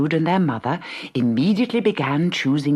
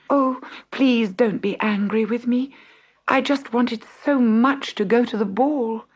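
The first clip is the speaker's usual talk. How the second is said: pleading Cinderella; 8 semitones higher than usual. Acted.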